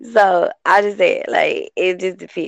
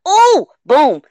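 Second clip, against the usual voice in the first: excited; 9 semitones higher, 6 dB louder than usual. Real, not acted. Pleased with own phrase.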